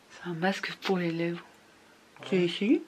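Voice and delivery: ordinary delivery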